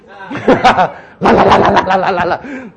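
joking rapid babble of la's; mock Singaporean tongues. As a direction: rattle off la's after a laugh. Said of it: "This is called Singaporean tongue".

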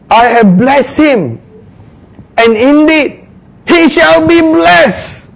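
high, slow, drawn-out pronouncement; solemn Isaac. Acted. Isaac confirming the blessing to Esau, slow solemn declaration. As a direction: declare it slowly like a trembling old father. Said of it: "then Isaac says in verse thirty-three".

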